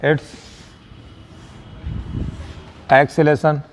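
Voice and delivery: long gap between the two words; halting pace for note-takers; word-by-word dictation. Shown as a demonstration. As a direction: dictate it one word at a time.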